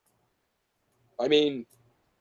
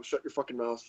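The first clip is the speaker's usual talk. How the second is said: normal talking voice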